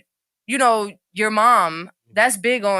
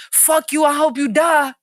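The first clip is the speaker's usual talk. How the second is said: higher, louder mock lashing out; imitated angry outburst; 5 semitones higher, 5 dB louder than usual. Retelling a girlfriend's trigger words, imitated anger. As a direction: act out a girlfriend's raised-voice curse.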